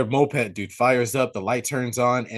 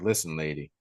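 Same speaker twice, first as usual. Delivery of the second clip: low, soft, controlled; calm, stern; 8 semitones lower, 8 dB quieter than usual. Retold or imitated. Sheriff to hysterical Nancy, calm and low. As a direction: keep it down low, calmly annoyed. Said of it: "he's calm about it".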